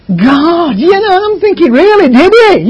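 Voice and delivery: high, loud mock disbelief; mocking the dreary reader; sarcastic retort. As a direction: scoff at it, pitched high and loud. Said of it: "And it's like".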